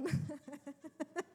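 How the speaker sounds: laughing at one's own joke. Real, not acted. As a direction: let out a real chuckle. Laughs after her onion joke.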